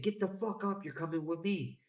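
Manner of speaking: imitating a gunman repeating himself, pitch up high; gunman's repeated order. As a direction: push the order harder a second time.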